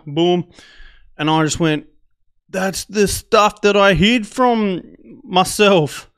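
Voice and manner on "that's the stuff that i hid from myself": raised, drawn-out disbelief; stunned realisation. Retold or imitated. Traveller retelling his shock at the find, stunned. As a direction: let it burst out, loud and slow.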